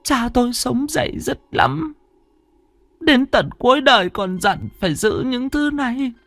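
put-on high, slow old woman's voice; old grandmother. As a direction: explain the family guilt as a grandma. Bà nội kể chuyện năm xưa. (giọng bà cụ, cao và chậm)

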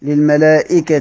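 للملائكة with final "-ti"; kasra reading. Shown as a demonstration. Teacher shows the unproblematic reading, للملائكة ending in -ti. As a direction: end للملائكة on a clear i after the t. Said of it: "على الجر".

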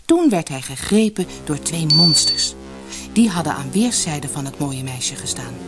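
plain reading voice

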